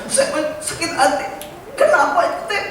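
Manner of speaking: aggrieved man. Acted. Preacher voices hurt Pak Tisna.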